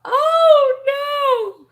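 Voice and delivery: loud, high mock wail; acted dismay. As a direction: cry out in exaggerated dismay. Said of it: "I was like".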